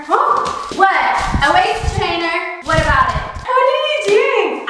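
high cooing voice at the dog; talking to pet. Pretend. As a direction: coo at the puppy like a baby.

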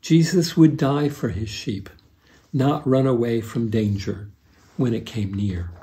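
normal talking voice

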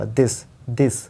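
this said with the tongue between the teeth; th demo. Shown as a demonstration. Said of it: "दोनों दांतों के बीच में ये टीएच बोलना पड़ता है".

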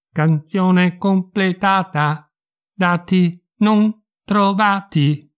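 slow, synthetic, toneless readout; flat computer voice reporting; emotionless robot. Acted. The house AI answers again.

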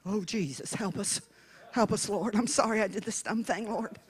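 softly mumbled, low little prayer; hushed mock plea. Regretful rider prays to the Lord, hushed mock plea. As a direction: plead under your breath, low and very soft. Acting a rider praying mid-ride.

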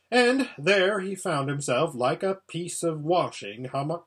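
plain reading voice

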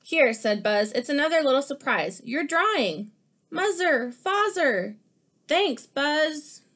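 lively picture-book read-aloud; animated storybook reading; reading to children. Acted. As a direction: read it like story time for kids.

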